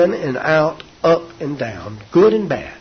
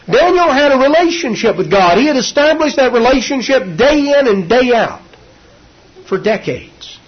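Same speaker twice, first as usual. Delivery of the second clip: raised, ringing preacher's voice; full preaching; 9 semitones higher, 5 dB louder than usual. Acted. Preacher extols Daniel's faith to his congregation, full force.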